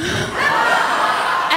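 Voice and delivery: high breathy giggle; a loopy drugged giggle; giggly laugh. Retold or imitated. Drugged mother laughing at her own words, breathy giggle. Drug-happy giggle after line.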